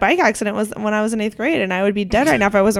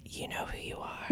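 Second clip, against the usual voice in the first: breathy whisper of a cryptic away message; 22 dB quieter than usual. Retold or imitated. Whispering a passive-aggressive away message to a friend. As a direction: hush it like a secret. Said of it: "it just says".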